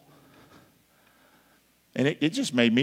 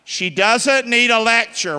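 louder, higher and slower; 10 semitones higher, 9 dB louder, slower than usual. Shown as a demonstration. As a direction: call it out like a congregation response. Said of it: "Say that out loud".

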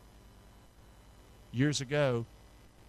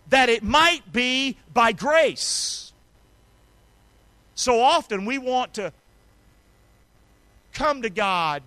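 high, loud preaching; ringing revival preacher; full-force preaching; 10 semitones higher, 6 dB louder than usual. Acted. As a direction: drive the scripture home to the church.

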